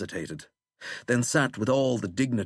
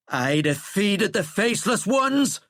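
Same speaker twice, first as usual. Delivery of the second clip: raised, louder angry retort; angry growl; 11 semitones higher, 5 dB louder than usual. A narrator's reading. President defending his victory to his general, angry growl. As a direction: growl it out with force and indignation. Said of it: "Flannery growled".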